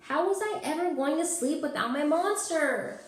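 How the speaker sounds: put-on high, fretful young voice; worried child. A narrator's reading. Child alone in bed worries about sleeping without a monster. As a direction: wail it like a kid at bedtime.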